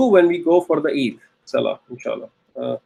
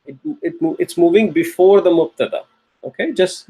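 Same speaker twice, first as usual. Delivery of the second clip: ordinary delivery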